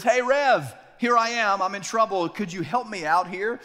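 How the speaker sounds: man in the hole calling to the pastor, eager. Retold or imitated. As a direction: shout up to the pastor for help. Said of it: "And he says".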